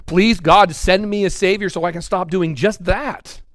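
loud, high plea to God; crying out. Retold or imitated. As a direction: cry out to God, pleading. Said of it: "crying out going".

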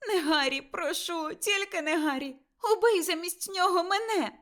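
high, loud pleading cry; desperate plea. A narrator's reading. Mother begging the killer to take her instead, high desperate plea. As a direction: offer your own life, begging.

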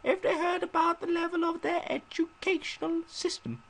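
high, slow falsetto reading; high-pitched letter voice. Acted. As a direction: read it in a squeaky put-on voice. Reads friend's letter in a voice.

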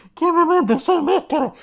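high loud gasping cry after running; breathless schoolgirl shout. Acted. Kyoko arriving after her sprint, high breathless shout. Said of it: "Kyoko llega al instituto y dice".

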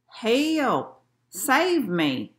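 half-strength storybook cry for help; mild mock yell. A narrator's reading. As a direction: call out drawn-out, without real volume. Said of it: "He was yelling".

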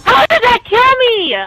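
high, loud outraged question; raging gamer. Real, not acted. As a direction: demand it in angry disbelief. Killed by magic, outraged.

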